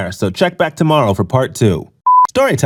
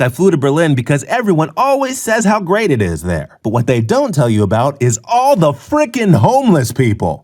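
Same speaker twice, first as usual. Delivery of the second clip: ordinary talk, nothing marked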